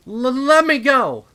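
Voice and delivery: high, loud stammering scream. Acted. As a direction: scream to break free, panicked. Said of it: "she screamed, growling like some feral animal".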